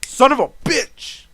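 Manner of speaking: yelled son of a bitch; loud exasperated curse. Retold or imitated. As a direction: explode in frustration. Venting at teleporting DMs.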